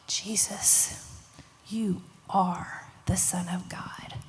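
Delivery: soft, low, slow reverence; gasp, then breathe it out in wonder; hushed awe. Acted. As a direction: awestruck near-whisper with pauses. Disciples worship Jesus in awe.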